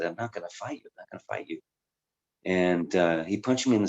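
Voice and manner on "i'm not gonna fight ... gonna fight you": quiet refusal. Retold or imitated.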